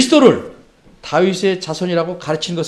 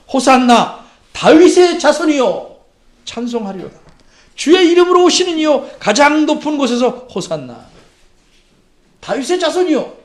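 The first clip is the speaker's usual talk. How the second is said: high, ringing acclamation; acclaiming; 7 semitones higher, 4 dB louder than usual. Acted. Crowds welcoming Jesus on the donkey, acclaiming. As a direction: hail a king with raised voice. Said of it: "예수님을 이렇게 환영했습니다".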